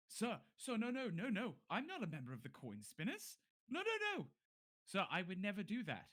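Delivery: high, frantic pleading guard; panicked denial. Acted. Guard begs the captain he's innocent, high panicked pleading. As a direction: beg the captain, voice cracking high.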